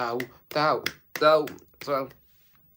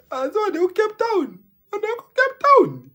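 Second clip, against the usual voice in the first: mock high exclamation; 6 dB louder than usual.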